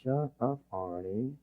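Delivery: very soft inner grumble; muttered aside. Acted. Polite leader's private thought about Arnie, quietly muttered. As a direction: mutter it under your breath. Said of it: "and you're going".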